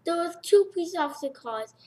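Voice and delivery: ordinary delivery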